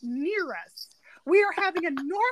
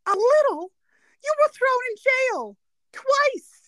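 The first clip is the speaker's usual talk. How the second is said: higher, louder, drawn-out scolding; upset Mona Lisa; 9 semitones higher, 5 dB louder, slower than usual. Retold or imitated. Fiancee upset with Vinny over the case, mock scolding. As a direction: scold him in mock outrage. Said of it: "And her response is".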